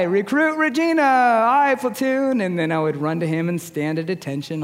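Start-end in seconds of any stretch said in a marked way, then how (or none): 0.0-2.4 much higher, louder yelled answer; recruit shouting reply. Retold or imitated. Recruit answering the platoon, high loud yell. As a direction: yell it like a nervous young recruit. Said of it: "to which I would reply".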